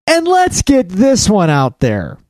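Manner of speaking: normal talking voice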